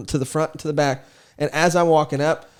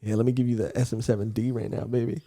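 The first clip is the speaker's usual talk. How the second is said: low, soft come-on; playful pillow-talk parody; mock seduction; 6 semitones lower, 8 dB quieter than usual. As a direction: drop low and quiet like a joke pickup line. Acts out a flirty pickup line.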